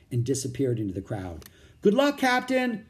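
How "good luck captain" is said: higher, louder farewell call. A narrator's reading. Jack calling after the departing captain, loudly. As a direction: send it after him across the crowd. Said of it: "Jack called".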